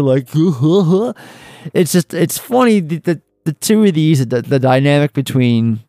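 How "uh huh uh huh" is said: higher, put-on mocking uh-huh; mocking mimicry. Host imitating the man mocking the woman, mocking echo. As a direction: mimic her uh-huhs in a silly raised voice. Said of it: "he, like, mocks her".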